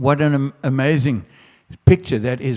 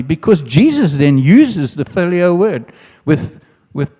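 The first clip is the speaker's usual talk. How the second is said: normal talking voice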